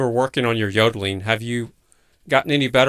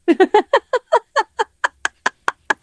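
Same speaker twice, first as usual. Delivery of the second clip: long, much higher, louder laugh; long laugh on request; 5 dB louder than usual. Pretend. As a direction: laugh as long as you can on cue. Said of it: "just give me a long laugh right now".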